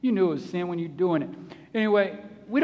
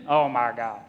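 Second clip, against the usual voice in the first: put-on tired old dog; 10 semitones lower than usual. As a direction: sigh it out like an arthritic old dog. Old dog voiced again.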